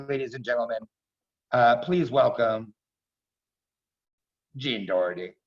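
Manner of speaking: ordinary delivery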